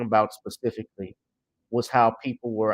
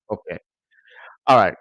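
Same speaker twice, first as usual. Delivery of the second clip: ordinary talk, nothing marked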